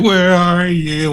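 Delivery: high, slow drawn-out wail; acted wailing call. Walking towards her in fake grief, wailing call. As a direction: call out mournfully, stretching each word.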